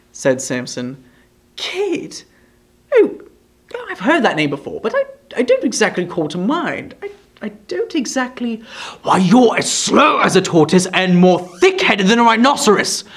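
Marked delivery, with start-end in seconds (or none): 1.6-8.6 high, hesitant stammer; lightly voiced fumbling Brass. A narrator's reading. Brass trying to recall Kit, stammering. 9.0-13.0 impatient. A narrator's reading. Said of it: "with an impatient gesture".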